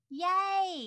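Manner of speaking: high, loud, drawn-out yay; excited cheer. Real, not acted. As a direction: cheer it out with delight. Cheering news of the recording.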